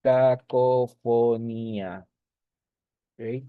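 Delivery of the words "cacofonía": "cacofonía" stretched slowly, syllable by syllable; drawn-out term. Shown as a demonstration. Teacher slowly stresses "cacofonía" for the class. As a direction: spell out "cacofonía" slowly and firmly. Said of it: "entonces estoy en un caso de".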